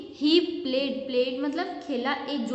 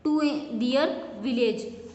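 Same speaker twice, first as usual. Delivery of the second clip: slow dictation; much slower than usual. Shown as a demonstration. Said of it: "teacher reads sentence aloud".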